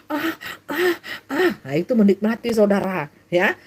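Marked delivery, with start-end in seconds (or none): 0.1-1.5 high, soft panting "ah"; mock moans of pleasure. Preacher mimics someone enjoying the sin, mock moaning. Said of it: "menikmati sampai".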